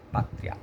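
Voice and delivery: soft "Pátria" split as pá-triA; stronger final A. Shown as a demonstration. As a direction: softly sound out "Pátria" so the A outweighs the I. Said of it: "O A é mais forte".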